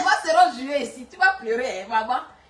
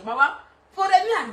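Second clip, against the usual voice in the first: ordinary talk, nothing marked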